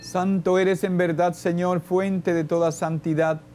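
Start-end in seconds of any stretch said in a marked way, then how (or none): none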